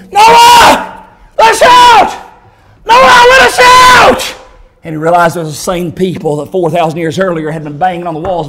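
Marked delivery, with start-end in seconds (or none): 0.1-4.4 high, loud screamed plea; desperate shouting. Acted. Trapped people calling to Noah from hell, desperate screaming. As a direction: scream to be let out.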